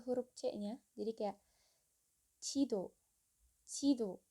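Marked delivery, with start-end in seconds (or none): 2.4-4.2 jido with a j close to ch; Korean pronunciation. Shown as a demonstration. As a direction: blend j and c at the start of jido. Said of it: "J sama C-nya agak deket disatuin". (jido dengan bunyi j mendekati c)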